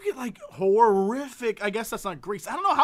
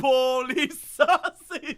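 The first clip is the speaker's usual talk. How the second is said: put-on slow laughing Chicago accent; 4 semitones higher, much slower than usual. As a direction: drag out Polish sausage in Chicago accent. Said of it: "my Chicago accent".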